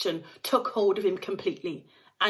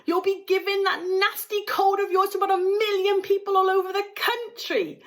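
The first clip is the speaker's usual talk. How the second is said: high, louder mother's voice; mother nagging her son at the river; fussy mother; 12 semitones higher, 5 dB louder than usual. A narrator's reading. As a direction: scold like a fretful mother. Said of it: "shouted Missus Gloop".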